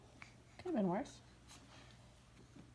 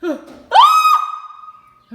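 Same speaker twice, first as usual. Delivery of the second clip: terrified scream; 19 dB louder than usual. Real, not acted. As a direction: gasp then a piercing high scream. Bird flies at her.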